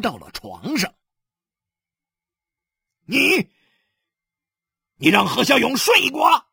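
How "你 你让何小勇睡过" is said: high, loud shouted question; furious accusation. Acted. Husband confronts his wife on the bed, furious.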